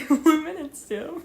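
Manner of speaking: laughing through the words. Real, not acted. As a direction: crack up while repeating it. Repeats, laughing.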